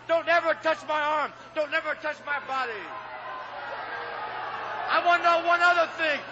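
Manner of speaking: usual speaking voice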